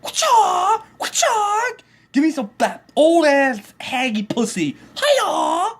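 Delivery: mocking a creepy husband. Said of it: "He's like".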